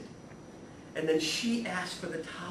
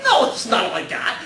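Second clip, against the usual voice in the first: laughing comeback; 4 semitones higher, 10 dB louder than usual. Retold or imitated.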